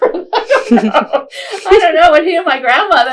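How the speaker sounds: real laughter bursting through the words; laughing. Real, not acted. Laughing about her grandfather the crazy motorcycle driver, loud laughter.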